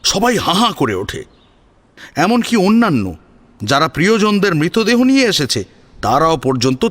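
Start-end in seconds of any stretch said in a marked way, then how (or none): none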